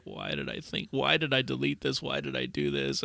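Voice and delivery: low, soft, sulky muttering; mopey regret. Retold or imitated. Son lamenting his own mistake, low and sulky. Son moping over deleted email.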